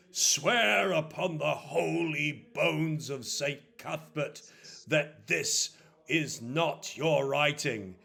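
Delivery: slow, loud, solemn command; stern priest demanding oath. Acted. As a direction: intone it as a holy challenge.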